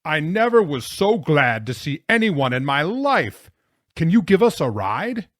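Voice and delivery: loud, high, elated shout; roaring. A narrator's reading. Jonathan overjoyed to see the plow driver, roaring. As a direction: bellow with huge relief. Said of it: "Jonathan roared".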